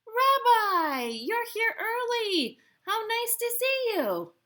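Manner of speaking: high, drawn-out cry of welcome; delighted greeting. Acted. Baba Braina welcomes the supposed rabbi at her door, delighted greeting. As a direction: greet a guest in a high, singing voice.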